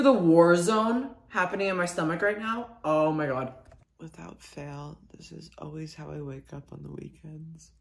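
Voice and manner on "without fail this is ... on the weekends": hushed sleepy whisper. Real, not acted. Vlogger just waking up next to her dog, whispering. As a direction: speak softly so as not to wake.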